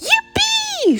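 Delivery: very high, loud whoop; excited shout. Acted. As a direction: whoop it out in triumph. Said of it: "shouted George".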